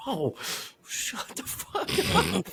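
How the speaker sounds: slow, whispered shut up; whispered aside to the older man; whispered retort. Retold or imitated. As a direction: hiss it under your breath. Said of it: "And I'm like".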